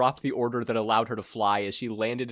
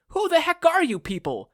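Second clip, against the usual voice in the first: much higher and louder demand; brash hero shout; 7 dB louder than usual. Acted. Hero confronts four villains, brash and loud. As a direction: challenge the strangers.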